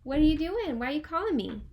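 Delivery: big, loud, high voice for a play phone call; hammed-up phone chat, loud; loud pretend phone call. Teacher hams up a phone call from Fred, loud and high.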